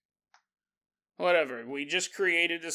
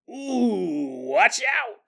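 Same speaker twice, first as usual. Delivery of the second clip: mock taunting; 6 semitones higher, 6 dB louder, much slower than usual. Imagined taunt from fellow reader.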